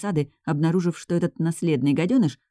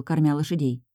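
ordinary delivery, nothing marked

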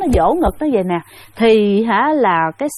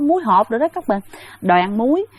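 ordinary talk, nothing marked

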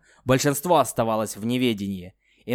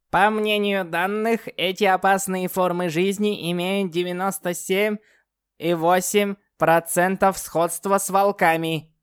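put-on old speech synthesizer; 7 semitones higher than usual. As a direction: read it flat like a machine. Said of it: "синтезированный голос Чипа".

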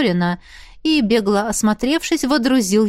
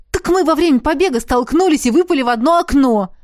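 high, animated account; lively, breakneck-adventure retelling; eager report; 6 semitones higher, 4 dB louder, faster than usual. A narrator's reading. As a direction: report it brightly, higher-pitched. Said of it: "отчитался Дин".

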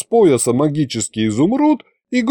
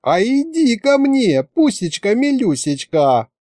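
put-on high, slow baby-talk coo; adoring coo; 10 semitones higher than usual. A narrator's reading. Masha calling the furry ghost over, cooing. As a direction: lure it sweetly like a pet. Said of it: "умилилась Маша".

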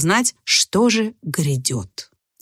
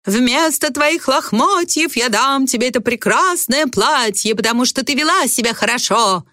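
grand theatrical fairy; stage voice; 6 semitones higher than usual. A narrator's reading. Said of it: "уже сценическим голосом".

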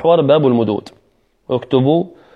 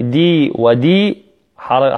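slow, drawn دي ودي; counting the beats; much slower than usual. Shown as a demonstration. Teacher counting the madd in دين. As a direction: tap out the two counts. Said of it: "دين".

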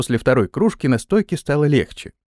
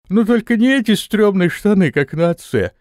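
yawning; 7 semitones higher, 3 dB louder than usual. A narrator's reading. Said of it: "зевая, ответил Антон".

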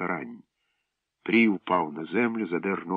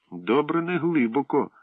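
mocking echo; 7 semitones higher than usual. Pri mocking Slavko.

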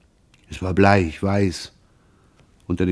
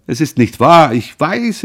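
desperate plea; 6 semitones higher, 6 dB louder than usual. A narrator's reading. Son pleads with sobbing mother.

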